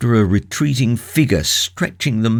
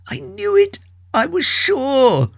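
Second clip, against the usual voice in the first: anguished triumphant cry. A narrator's reading. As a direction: cry it out, triumph torn with pain. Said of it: "cry of inconceivable triumph and of unspeakable pain".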